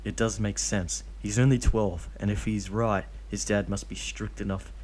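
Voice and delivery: plain reading voice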